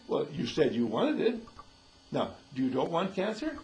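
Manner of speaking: ordinary talk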